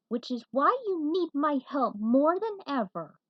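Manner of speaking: a mild newcomer's boast; smug stranger. A narrator's reading. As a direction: sell your help with a grin. Nick appears, a character voice.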